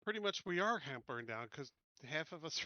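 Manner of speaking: ordinary talk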